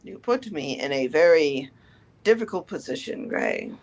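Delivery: put-on low, grave old mentor; old teacher voice. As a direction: speak as a weary old teacher.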